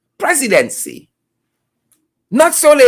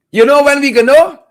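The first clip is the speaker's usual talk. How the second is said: ordinary talk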